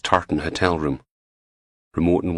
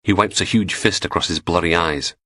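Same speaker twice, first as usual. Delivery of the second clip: plain reading voice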